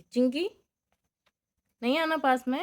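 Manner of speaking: loud, high warning to a pet; sharp call. Real, not acted. Owner telling Chinki not to come near, loud high warning. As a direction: shoo the rabbit away loudly.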